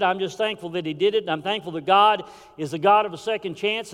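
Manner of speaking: normal talking voice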